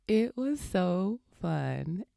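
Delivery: slow, amused, through laughter; laughing. Real, not acted. Mother recalling her son's first run, laughing. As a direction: laugh your way into it.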